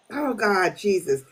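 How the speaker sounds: usual speaking voice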